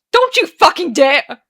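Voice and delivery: slow, high, very loud warning; furious interruption. Acted. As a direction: cut him off with a furious shout. Arthur explodes, interrupting Daniel.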